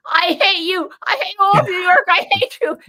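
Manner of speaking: mock the juror's outburst; furious juror. Retelling a juror screaming at the lawyer, mock yell. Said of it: "finally he says".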